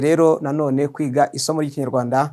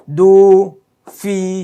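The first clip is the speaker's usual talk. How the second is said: louder repeat; 3 semitones higher, 6 dB louder than usual. Shown as a demonstration. Teacher drills dufite.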